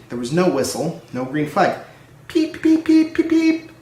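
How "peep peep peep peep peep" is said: high, loud repeated peeps; impatient whistling. A narrator's reading. Edward whistling for the late guard, impatient peeps. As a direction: toot over and over, impatient.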